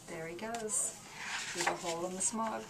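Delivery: storyteller quietly watching the Lorax leave; hushed. Acted. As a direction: watch him go and whisper it off. Lorax vanishing, soft aside.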